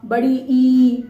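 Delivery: बड़ी ई with the ई held long and slow; teacher demo of a long vowel; drawn-out long ई. Shown as a demonstration. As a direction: stretch the ई out like a teacher. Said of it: "तो वो बड़ा आ".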